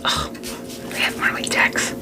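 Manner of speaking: excited little whisper. Retold or imitated. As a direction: hush it like a secret. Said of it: "I was like".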